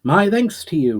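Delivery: theatrical thanks. A narrator's reading. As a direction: play up a grand courtly bow. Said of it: "with a theatrical bow".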